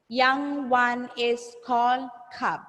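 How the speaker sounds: slow, drawn-out teacher modelling; slow repeat-after-me. Shown as a demonstration. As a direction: space out each word for pupils. Model sentence for pupils to repeat.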